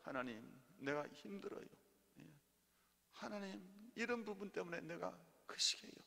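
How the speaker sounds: soft, weary plea in prayer; praying to God. Acted. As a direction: confide quietly to God, acting it out. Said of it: "하나님한테 막 기도하고 끝나는 것이 아니라". (조용히 하소연하는 기도 흉내)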